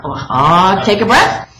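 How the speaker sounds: ordinary talk, nothing marked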